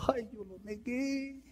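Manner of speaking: high, hushed anguished lament; Peter's lament. Acted. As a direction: grieve softly as Peter who denied him. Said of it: "haber dicho". (lamento quedo y agudo de Pedro)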